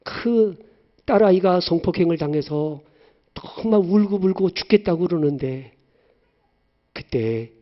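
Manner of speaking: normal talking voice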